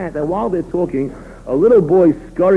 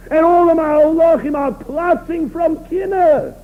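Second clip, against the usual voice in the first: normal talking voice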